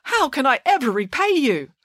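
overflowing with thanks; high fussy thankful man; grateful Ali Baba. A narrator's reading. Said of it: "said Ali Baba".

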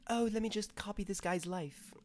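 high put-on mocking voice; mocking quote. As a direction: lift the voice right up to ridicule it. Mocks the film in a put-on voice.

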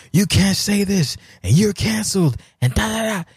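high, sing-song mock scolding; high, sing-song imitation of a scold. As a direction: lampoon the cancel crowd in a high voice. Mocking the scolds.

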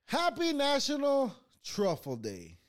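high, loud, drawn-out holiday cheer; mock celebratory announcement. Host announcing National Truffle Day to viewers, mock celebratory. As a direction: proclaim it big and slow like an announcer.

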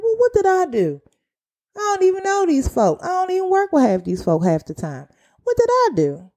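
high-pitched mock bewilderment; baffled protest. Worker replaying her reaction to being blamed, baffled protest.

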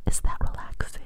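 teasing soft whisper. Acted. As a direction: whisper it with a grin. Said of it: "I can't".

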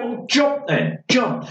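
mock dare; goading dare. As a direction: egg him on to jump. Daring him to jump.

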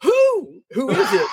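sudden high squeak of surprise; startled. Retold or imitated. Boaz waking startled at midnight, squeaking who. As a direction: jolt awake and squeak Who. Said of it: "he wakes up and he's like".

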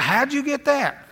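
a hint of a puzzled wife; wife's surprised question. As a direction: play the wife, curious and a bit suspicious. Wife asks about the card.

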